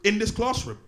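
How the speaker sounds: loud, high stern teacher scolding; strict teacher dressing down a pupil; teacher telling off class. Acted. Comedian acting stern teacher scolding.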